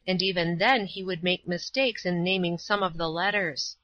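usual speaking voice

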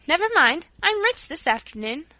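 high, loud, breezy brush-off; carefree excitement. A narrator's reading. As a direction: wave the worry away gleefully. Child brushes off the scolding.

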